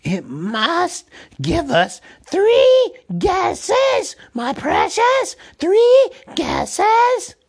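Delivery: high, loud, slow creature shout; demanding Gollum voice. A narrator's reading. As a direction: insist like a greedy cave thing.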